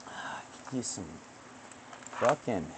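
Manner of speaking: usual speaking voice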